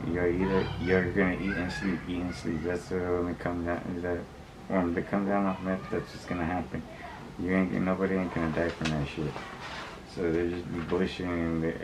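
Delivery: normal talking voice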